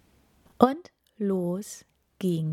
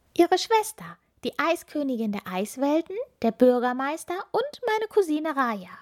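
put-on very high, bright child voice; little witch girl; 8 semitones higher than usual. A narrator's reading. Little witch Ilvi introducing herself to the hermit, high child voice. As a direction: play a small eager witch girl.

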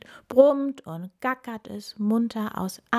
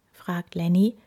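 ordinary delivery

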